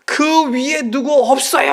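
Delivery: high, loud, slow desperate yell; last-ditch shout up the cliff. Retold or imitated. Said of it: "더 크게 소리를 질렀답니다".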